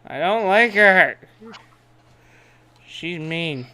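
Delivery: ordinary talk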